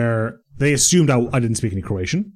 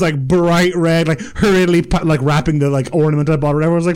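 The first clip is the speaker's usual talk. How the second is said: ordinary talk, nothing marked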